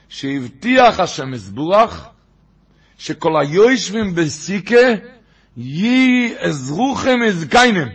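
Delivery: slow, loud, drawn-out recitation of a sacred text; slow emphatic reading. Shown as a demonstration. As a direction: declaim it word by word, stretching the vowels. First solemn reading of quote.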